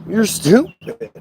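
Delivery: high, slow "stupid"; "stupid" dragged out for emphasis; drawn-out insult. Retold or imitated. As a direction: stretch out STUPID, pitched high.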